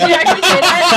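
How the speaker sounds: high, loud laughing talk. Real, not acted. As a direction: crack up mid-sentence. Players burst out laughing.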